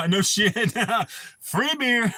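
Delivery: laughing through the words; real laugh breaking into the line. Real, not acted. Laughing at free beer story.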